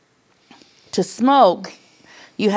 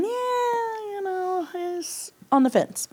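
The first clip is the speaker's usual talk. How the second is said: wry; 13 semitones higher, 4 dB quieter, much slower than usual. Real, not acted. Describing doubting son.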